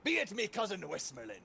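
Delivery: lightly voiced pirate reciting Shakespeare; hint of pirate, declaiming Shakespeare. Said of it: "quote from Shakespeare, but talk like a pirate".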